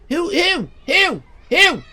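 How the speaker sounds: loud, high whoops; high whooping. Real, not acted. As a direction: whoop it out in short bursts. Whooping after spotting another streamer live.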